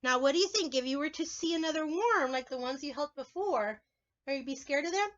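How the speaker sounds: ordinary talk, nothing marked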